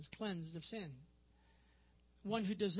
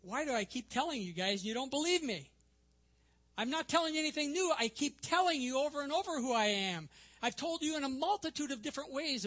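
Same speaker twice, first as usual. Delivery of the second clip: raised, louder, frustrated repeating; exasperated insistence; 6 semitones higher, 5 dB louder than usual. Acted. Jesus to disbelieving leaders who keep doubting him, exasperated. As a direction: insist as if explaining for the umpteenth time. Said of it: "the idea seems to say".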